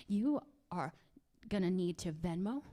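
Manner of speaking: ordinary delivery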